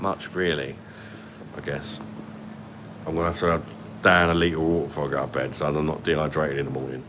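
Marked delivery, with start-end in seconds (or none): none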